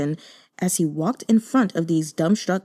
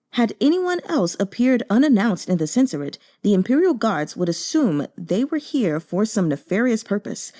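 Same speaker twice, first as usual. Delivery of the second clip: plain reading voice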